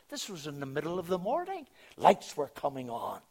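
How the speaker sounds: loud, high exclamation of amazement; amazed emphasis. Retold or imitated. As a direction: raise the voice and marvel at it. Amazed repeat of the same words.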